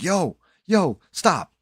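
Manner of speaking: loud alarmed call to stop; urgent shout. Retold or imitated. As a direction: cut the rant off with an alarmed yell. Friend cuts in on the rant.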